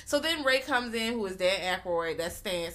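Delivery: ordinary delivery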